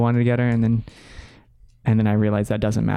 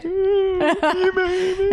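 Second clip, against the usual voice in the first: high, half-sobbing laugh of relief; laughing cry; much slower than usual. Retold or imitated. Proposing on one knee, he laughs through tears of relief. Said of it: "I'm like".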